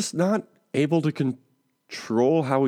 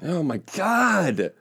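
exasperated; 5 semitones higher, much slower than usual. Pretend. Mock exasperation at accents.

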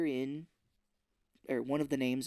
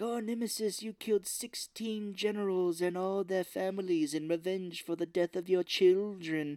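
put-on grand recital; mock theatrical villain; 5 semitones higher than usual. Villain reciting the lady's crimes, mock theatrical. Villain addressing Nemesis in recap.

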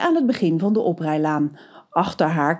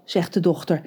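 ordinary delivery, nothing marked

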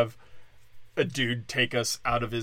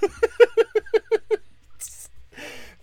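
airy, wheezing laugh; breathless laugh; 6 dB louder than usual. Real, not acted. Laughs at own joke.

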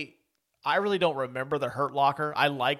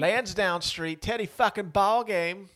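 slow, higher hammed-up Boston accent; bad Boston accent; 6 semitones higher, 3 dB louder, slower than usual. Co-host echoing the quote in a bad Boston accent. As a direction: belt it out in a mock Boston accent. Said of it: "that Boston accent's bad".

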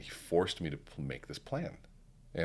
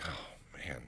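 soft whispered oh man; whispered dismay; 9 dB quieter than usual. Retold or imitated. Founder told to turn the company around, whispered dismay. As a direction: breathe it out under your breath. Hearing the mentor's challenge.

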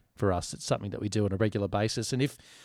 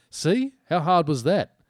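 high, loud mock exasperation; loud, high-pitched I-told-you-so; exasperated vindication; 7 semitones higher, 7 dB louder, slower than usual. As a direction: imitate a vindicated friend, pitched right up. Retold friend's exasperated vindication.

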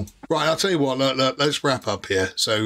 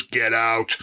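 put-on holy voice; 2 semitones lower than usual. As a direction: intone it like a heavenly command, jokingly. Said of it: "this holy voice goes".

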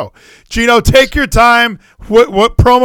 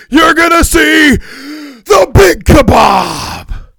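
higher, loud shouted promo as a kebab; wrestling promo; 5 semitones higher, 3 dB louder than usual. Acted. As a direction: yell it like a wrestler hyping a match. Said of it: "now you're a fucking shish kebab... Go".

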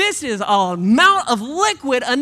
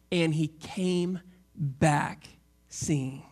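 slow, tender; 9 semitones lower, 6 dB quieter, much slower than usual. Acted. Reflective slow climax.

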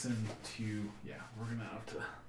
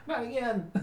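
put-on scared kid; 11 semitones higher than usual. [name] responds to bully.

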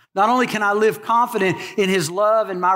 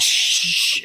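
shushing. Shown as a demonstration. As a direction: hush the crowd like at tennis. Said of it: "everybody don't say anything".

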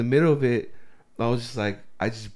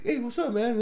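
forced casual greeting; 10 semitones higher than usual. Retold or imitated. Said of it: "And I was like".

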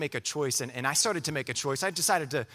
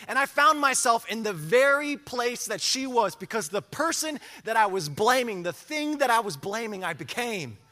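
much higher and louder, a preacher in full flight; preaching at full force; 10 semitones higher, 6 dB louder than usual. Acted. Preacher testifying to the church, building to full-force preaching.

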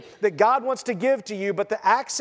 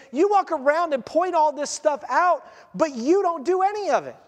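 higher, heated rebuke; 6 semitones higher than usual. Acted. Jesus confronting the lawyers.

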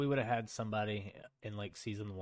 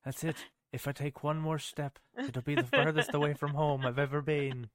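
higher, lightly voiced Hobbit, leprechaun-like; a hint of a leprechaun lilt; 5 semitones higher, 5 dB louder than usual. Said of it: "It was supposed to be my Hobbit, Hobbit voice".

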